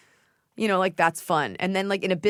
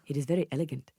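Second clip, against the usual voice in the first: lightly attempted Nigerian accent, lower; 3 semitones lower, 5 dB quieter, faster than usual. Shown as a demonstration. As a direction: have a clumsy go at a Nigerian accent. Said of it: "like, a Nigerian accent".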